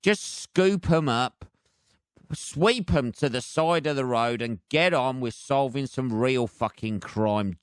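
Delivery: normal talking voice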